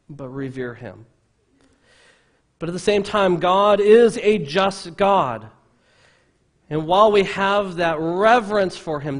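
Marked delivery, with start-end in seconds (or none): 2.6-9.2 loud, high, emphatic preaching; full-force preaching. Real, not acted. Preacher telling the church God is just, full-force preaching. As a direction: drive it home from the pulpit, raised voice.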